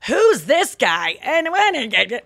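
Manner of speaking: exaggerated mom character, pitch swooping; loud, singsong mom impression; [name]. Shown as a demonstration. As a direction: ham it up as a baffled mom. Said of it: "I'm almost doing a character".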